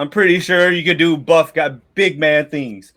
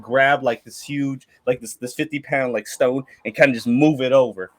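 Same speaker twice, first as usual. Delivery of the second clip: ordinary delivery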